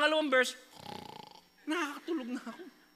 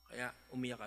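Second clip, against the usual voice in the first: ordinary talk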